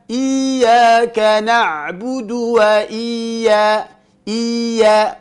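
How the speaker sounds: IYYAKA chanted as a long II with unpressed Y; wrong long-vowel ya. Shown as a demonstration. Teacher showing what to avoid, ya softened into a long vowel. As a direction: slide through IYYA as II-YA, without doubling. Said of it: "Jangan dibaca".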